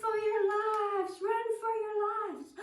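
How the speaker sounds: high, loud shout of terror; panicked shout. Acted. Friends fleeing the growl, panicked high shout. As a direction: scream for them to run.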